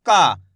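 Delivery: ka said low and clearly, as a model syllable; teacher saying ka. Shown as a demonstration. Teacher models का for students to copy. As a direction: say का as a plain short ka for students to repeat. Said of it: "का कैसे उच्चारण करेंगे इसका".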